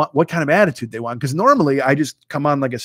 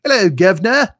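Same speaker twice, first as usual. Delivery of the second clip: loud, higher-pitched cartoon British greeting; mock British accent; 5 semitones higher, 6 dB louder than usual. Shown as a demonstration. Showing he can't smooth it out, exaggerated British accent. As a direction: call it out in a hammy British accent. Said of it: "smooth it out and just be like".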